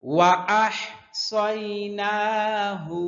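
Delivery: slow tajweed chant; Quran recitation. Shown as a demonstration. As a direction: recite the verse slowly and evenly. Recites verse slowly. (ধীর সুরেলা তিলাওয়াত)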